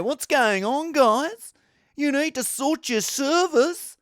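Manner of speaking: exasperated Australian accent impression; Neil Robertson. Snooker player moaning about crashed servers, Australian accent. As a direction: complain in a put-on Australian accent. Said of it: "He's from Australia. He's like".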